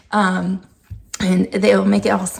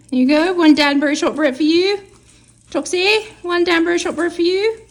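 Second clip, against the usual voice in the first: higher, quick cheery treat-giving; bright dog-praise voice; 7 semitones higher, 4 dB louder, faster than usual. Real, not acted. Owner handing cookies to her dogs, cheery higher voice. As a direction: chirp it to the dogs.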